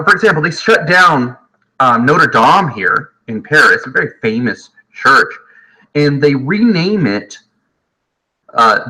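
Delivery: normal talking voice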